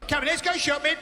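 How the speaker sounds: brisk host rallying; mock Greg Wallace. Comic as Greg taking charge. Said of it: "Greg rallying family".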